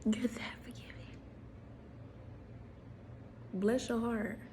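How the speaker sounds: soft whispered tease; whispered confidential aside. Real, not acted. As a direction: breathe it like a secret compliment.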